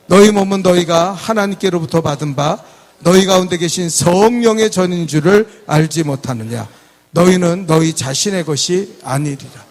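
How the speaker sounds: loud, measured congregational reading; projected Bible recitation; scripture read aloud. Acted. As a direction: read the verse out for all to follow.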